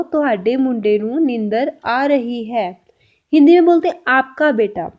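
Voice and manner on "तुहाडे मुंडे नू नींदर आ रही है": slow, careful Punjabi sentence; slow Punjabi demo. Shown as a demonstration. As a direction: spell it out slowly for learners. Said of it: "Punjabi में कैसे बोला जाएगा?". (धीमे-धीमे सिखाते हुए पंजाबी में)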